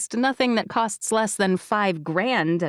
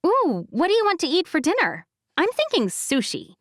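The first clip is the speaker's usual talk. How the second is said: higher, quick, eager friend; excited friend; 9 semitones higher than usual. Acted. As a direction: bounce into the dinner idea.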